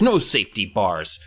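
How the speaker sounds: ordinary talk, nothing marked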